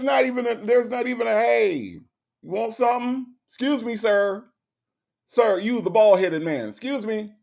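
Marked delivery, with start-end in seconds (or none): 1.3-7.3 higher, sing-song calling out to a customer; mock eager server. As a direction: act like a waiter trying to flag down a bald man. Imitating staff offering to him.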